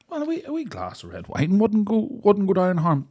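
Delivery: high put-on voice; mock polite request. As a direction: play it dainty and polite.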